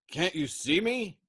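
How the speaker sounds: high, loud, slow disbelieving cry; can't believe he isn't noticed; wounded man crying out to a deaf crewmate; incredulous cry. Acted. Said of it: "Yossarian cried incredulously".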